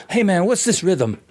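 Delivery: high-pitched imitation of an eager student; eager pestering. Student running up to guys daily, high eager pestering. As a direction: pester them for help, pitched right up. Pestering classmates, acted in story.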